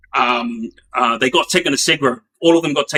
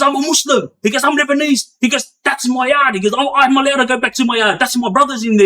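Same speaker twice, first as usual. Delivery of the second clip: pitched high, heated insistence; voicing an inmate losing it over his yard; retold angry protest; 8 semitones higher than usual. Inmate protesting to the governor, high heated mimicry. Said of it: "He was going off his head".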